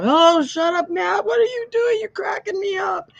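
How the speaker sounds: high, delighted squeal at the screen; gushing at a chat friend; squealing fan voice at the screen; talking back to the screen. Retold or imitated.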